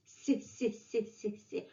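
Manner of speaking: "си" hissed softly and quietly; soft s sound. Shown as a demonstration. Teacher demonstrating the soft "си" sound, quiet. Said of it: "soft sign".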